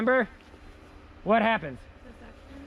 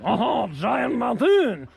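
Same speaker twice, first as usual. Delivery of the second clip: slow, louder hammed-up French accent; 5 dB louder, much slower than usual. Shown as a demonstration. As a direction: put on a thick French accent. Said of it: "say it with a French accent".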